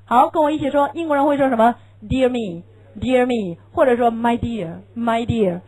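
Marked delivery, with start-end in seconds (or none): none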